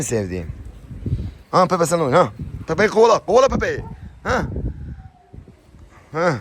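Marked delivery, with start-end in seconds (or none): 1.5-6.3 urging the dog. Real, not acted. Owner urging Pepe to chase the puppy, high eager coaxing.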